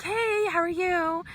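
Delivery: high, slow, sugary fake-friendly greeting; a forced bright hello to someone hostile; forced cheery hello. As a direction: sing it out sweetly through gritted teeth.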